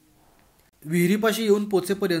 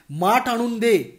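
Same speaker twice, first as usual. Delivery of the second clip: slow, over-articulated "माठ आणून दे"; slow emphatic request; 5 semitones higher, 5 dB louder, slower than usual. Acted. Bhagwan miming to the deaf girl, slow exaggerated words. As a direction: say it slowly, mouthing every word. Spelling out with gestures.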